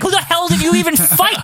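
high, scoffing; incredulous. Real, not acted. Fan mocking Hogan's title claim, high incredulous scoff. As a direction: ask it in disbelief. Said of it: "incredulous rant".

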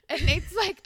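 ordinary talk

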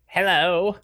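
high strange alien voice; alien Gargalax. Acted. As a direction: play the alien dad greeting. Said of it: "yeah, let's bring him out".

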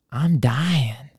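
breathy whisper; whispered plea. Retold or imitated. Stranger pleading to school worker for a liver, whispered.

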